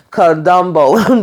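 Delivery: slow, savoured Kadumbo with rising end; drawn-out nickname. Retold or imitated. Sam mocking Burke, slow drawn-out Kadumbo. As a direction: stretch out Kadumbo like a punchline. Quoting Sam's funny nickname.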